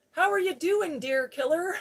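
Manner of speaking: loud, high teasing greeting. Retold or imitated. Sheriff ribbing the driver about the deer, loud teasing. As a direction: call it out loudly to rib someone. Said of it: "I'm just teasing you".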